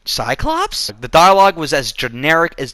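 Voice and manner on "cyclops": incredulous question. Retold or imitated. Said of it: "I'm like".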